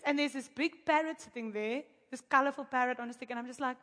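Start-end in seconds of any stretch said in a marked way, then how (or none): none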